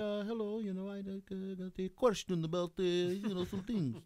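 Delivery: high-pitched put-on Russian accent. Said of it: "Just do the fucking accent".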